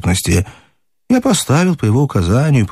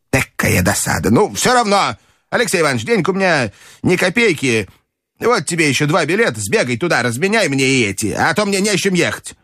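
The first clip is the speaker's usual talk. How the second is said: commanding grandmother; 7 semitones higher than usual. A narrator's reading. Grandmother ordering the narrator to bet, high and commanding.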